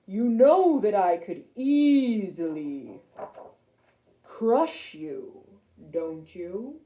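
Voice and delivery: slow, imperious lion king; big booming voice. A narrator's reading. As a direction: lord your power over a tiny creature, slowly. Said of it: "a very big booming voice".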